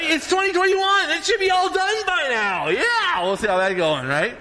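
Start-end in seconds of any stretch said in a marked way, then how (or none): 0.0-3.2 very high-pitched impatient whine; mock exasperation. Impatient for it all to end, mock whining. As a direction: push the complaint way up high, hammed-up.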